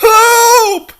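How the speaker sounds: high, loud screaming for help; desperate shout for help. Acted. Princess dangling from the window screams for help. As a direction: yell from the ledge for anyone to hear.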